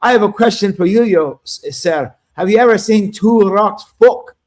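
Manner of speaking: lightly put-on Scottish brogue, slowing on the last line; Scottish accent. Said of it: "so I used a Scottish accent and I said".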